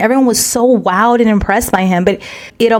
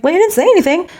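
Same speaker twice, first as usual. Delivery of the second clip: retort; 9 semitones higher, faster than usual. Retold or imitated. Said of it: "I'm like".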